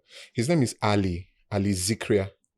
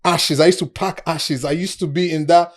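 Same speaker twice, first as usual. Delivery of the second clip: louder and higher, bragging with a laugh; excited shout; 8 semitones higher, 8 dB louder than usual. Retold or imitated. Old friend boasting about his past on the phone, excited shouting. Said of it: "And he's shouting".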